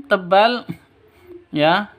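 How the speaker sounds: ordinary delivery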